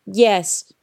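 flatly voiced stalker reply. A narrator's reading. Stalker confirms to the girl it will kill, flatly. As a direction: answer firmly, without feeling. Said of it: "said Shrike flatly".

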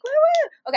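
high, loud double whoop; celebratory woo-woo; cheering whoops. Real, not acted. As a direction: cheer with two high woos. Cheering for boys on deployment.

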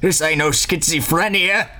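put-on high, drawn-out old man's scolding. Old man slaps Hunter and tells him off, scolding old-man voice. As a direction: scold like an old man, stretching the last words. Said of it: "the old man slaps the dark-headed human".

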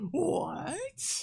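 high-pitched, playful disbelief; mock surprise. Host teasing guest about band drama, mock surprise. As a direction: feign shock with a squeaky upward leap.

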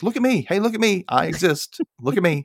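high-pitched mock pleading for notice; attention-seeking kid. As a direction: beg to be noticed like an ignored little sister. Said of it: "I was totally Danielle".

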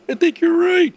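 very high, squeaky agreement; exaggerated high-pitched imitation; mock guest voice. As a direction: squeak it out in falsetto. Retold guest answers tearfully agreeing.

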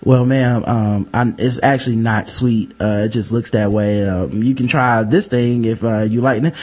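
low, soft, careful server warning; polite server voice. Retold or imitated. Server gently warning the customer, low and soft.

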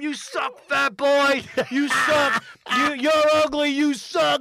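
rising mock yells at the comic; retold heckling. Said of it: "They're just screaming at him".

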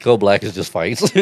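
usual speaking voice

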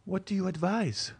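put-on high, light young woman's voice; sighing question. A narrator's reading. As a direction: ask with helpless resignation, pitched high. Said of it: "raising her eyes to heaven and sighing".

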